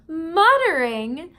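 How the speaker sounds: Muttering called out loud, rising at the end; teacher booming Muttering to show not to mutter; said nice and loud. Shown as a demonstration. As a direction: say Muttering loudly, the opposite of muttering. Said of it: "Nice and loud. Don't mutter it".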